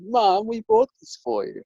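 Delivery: put-on high-pitched pleading as a kid; childlike protest. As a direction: imitate a child coaxing mum. Child protests to mum in story.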